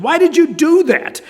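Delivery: high, exasperated plea; a worn-out referee begging for answers; pleading. Acted. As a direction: beg him to explain himself. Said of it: "the referee pleads".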